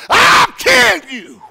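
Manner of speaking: very high, loud, slow roar; furious yell. Retold or imitated. Pastor spits out cake and yells at speaker, furious. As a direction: explode with rage.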